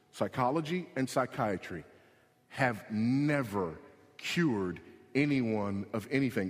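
very slow, deliberate repetition; slow right down, word by word; slowly. Shown as a demonstration. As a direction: spell the claim out slowly with gaps between words. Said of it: "Let me say that one more time slowly".

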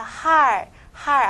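할 said hal, ending on l; left-consonant reading. Shown as a demonstration. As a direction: read 할 with only the l closing it. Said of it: "那么读左边的".

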